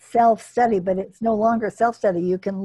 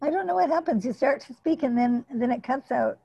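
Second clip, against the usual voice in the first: ordinary talk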